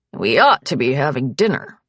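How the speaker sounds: lightly voiced older man, dry; a hint of a blunt old patriarch teasing; gruff old father. Old patriarch teasing his wife about the guest, lightly gruff.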